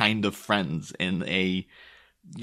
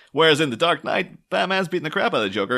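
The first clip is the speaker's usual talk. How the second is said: ordinary talk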